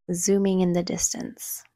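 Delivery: ordinary delivery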